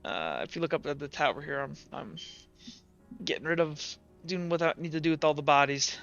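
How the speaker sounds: normal talking voice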